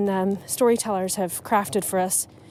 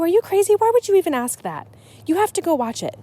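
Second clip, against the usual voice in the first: higher and louder, playfully scandalized; mock outrage; 8 semitones higher, 3 dB louder, faster than usual. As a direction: act shocked that anyone would ask. Mock outrage at spoiler request.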